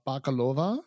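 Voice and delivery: usual speaking voice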